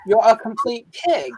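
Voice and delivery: Australian accent, cheerful lift at the end; Australian upward lilt. Shown as a demonstration. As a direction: say it like an Aussie, lifting up at the end. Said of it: "you have to lift up at the end".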